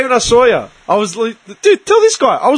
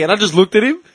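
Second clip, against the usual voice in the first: normal talking voice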